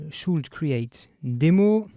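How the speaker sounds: English words in a French accent, slowly; slow English with a real French accent. Real, not acted. Tutor naming a request in English, French-accented English. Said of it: "je m'excuse pour mon accent anglais".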